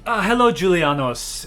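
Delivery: lightly voiced fussy hairdresser greeting; breezy salon greeting. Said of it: "this hairdresser voice".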